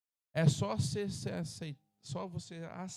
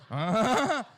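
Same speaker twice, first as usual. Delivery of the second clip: loud braying mock laugh, higher; imitated laugh; 5 semitones higher, 11 dB louder than usual. Pastor imitating his wife's laugh for the church, loud braying mock laugh. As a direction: bray it like a car stalling. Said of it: "Fica rindo".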